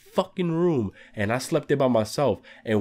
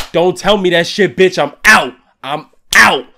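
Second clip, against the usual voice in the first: loud, emphatic outburst in a retelling; punchy, louder mock-panicked refusal; 3 semitones higher, 9 dB louder than usual. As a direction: shout it like you're bailing immediately. Acts out refusing the creepy story.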